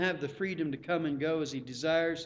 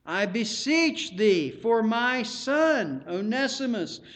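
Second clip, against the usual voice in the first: slow, raised, fervent plea; appealing; 5 semitones higher, 5 dB louder, slower than usual. Acted. Paul appealing to Philemon for Onesimus, fervent plea. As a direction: plead slowly for a son. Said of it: "He's appealing".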